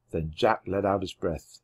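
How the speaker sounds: ordinary delivery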